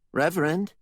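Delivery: high questioning call; a woman calls out to him; Norma, calling. Acted. Norma calls the Reverend, high questioning call.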